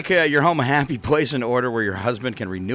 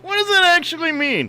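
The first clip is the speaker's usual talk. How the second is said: high pleading, half-crying question; a playful fake sob of confusion; mock baffled; 14 semitones higher than usual. Real, not acted. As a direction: whine it in mock despair. Mock distress at phrase.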